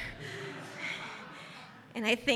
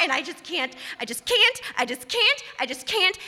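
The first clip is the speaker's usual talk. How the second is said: normal talking voice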